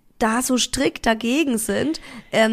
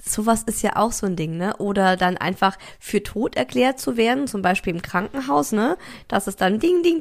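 usual speaking voice

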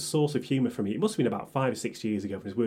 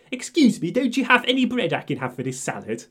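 posh customer; 9 semitones higher, 4 dB louder than usual. Retold or imitated.

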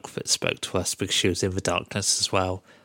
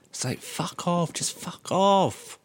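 drawn-out, higher, fed-up fuck off; exasperated; 9 semitones higher, slower than usual. Real, not acted. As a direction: groan it out, sick of the story. Annoyed at the cutscene.

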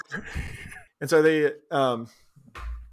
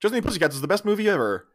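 fast ad read; 3 semitones higher, faster than usual. Retold or imitated. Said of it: "real quick on the screen".

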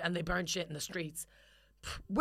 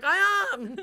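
high, strained mock protest; 9 semitones higher, 4 dB louder than usual. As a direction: imitate an indignant squeal back. Acting Ian's reply to coaching.

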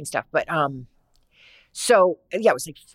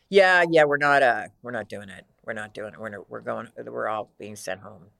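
low, unhurried impression of a friend; deadpan low-voiced friend; Kristen Johnson; 7 semitones lower than usual. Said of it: "she has that low voice".

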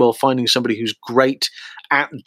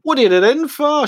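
Liverpool restaurant man; 11 semitones higher than usual. Retold or imitated. Restaurant man voiced in story.

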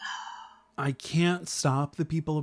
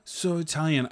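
proud boast; 6 semitones higher, 6 dB louder, slower than usual. Real, not acted.

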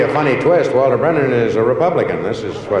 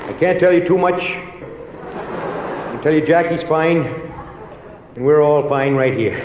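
higher-pitched politician impression with drawn-out words; Kennedy; 5 semitones higher, slower than usual. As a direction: do a slow, measured campaign-speech voice. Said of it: "I don't know why Kennedy came to mind".

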